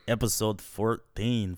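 usual speaking voice

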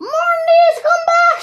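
high, loud call after fleeing crew; Captain Bones shouting. A narrator's reading. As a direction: yell to call them back. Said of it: "shouted Captain Bones".

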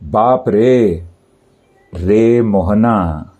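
very slow, stretch the vowels in रे and Mohana; drawn-out calling vowels; prolonged pluta vowels. Shown as a demonstration. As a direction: hold रे and the final ना of Mohana three times as long. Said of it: "उच्चारण में एक मात्रा का तिगुना समय".

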